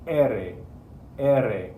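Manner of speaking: "R" as E-re, one tap, repeated with pauses; single-tap ere. Shown as a demonstration. Teacher leading students to practice aloud, single tongue flap. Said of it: "please be practicing it".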